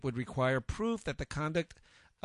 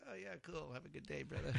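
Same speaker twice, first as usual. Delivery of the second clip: unbothered chill reply; 17 dB quieter than usual. Retold or imitated. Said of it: "I'm like".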